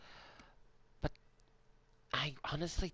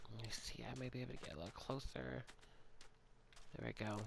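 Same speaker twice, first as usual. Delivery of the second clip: quiet; 10 dB quieter, faster than usual. Real, not acted. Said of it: "I kinda need to be quiet".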